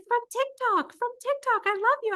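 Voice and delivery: high, eager fan voice; excited fan voice. Retold or imitated. Fan greeting her at a crosswalk, high and eager. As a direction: gush like a starstruck fan.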